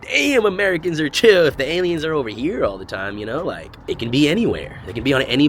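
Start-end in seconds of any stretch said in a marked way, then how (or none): 0.0-3.2 mock. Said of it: "put on your big boy cap and be like".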